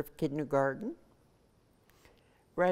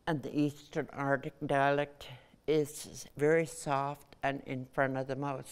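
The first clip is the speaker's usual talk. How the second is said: ordinary talk, nothing marked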